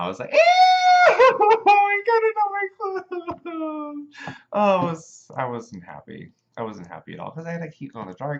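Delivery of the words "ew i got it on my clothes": very high, loud squealing freak-out; drawn-out high squeal of disgust; flipping out. Retold or imitated. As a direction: reenact a panicked, grossed-out fit at piercing pitch. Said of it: "I was flipping out".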